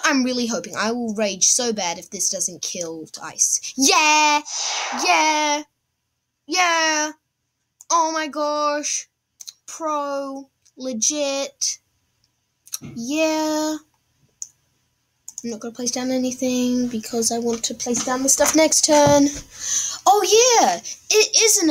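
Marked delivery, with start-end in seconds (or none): 3.8-13.8 high joyful yeahs; excited cheering. Real, not acted. Celebrates a kill. 20.0-21.7 loud high whoop; excited. Real, not acted.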